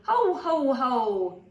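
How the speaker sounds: loud, high booming ho ho ho; Santa roaring. A narrator's reading. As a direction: Santa laughing big at the kids. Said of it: "Santa roared".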